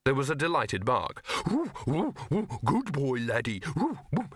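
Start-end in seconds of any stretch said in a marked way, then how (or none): 1.5-4.3 high eager yipping dog voice; excited dog. Acted. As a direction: gush like a happy dumb dog.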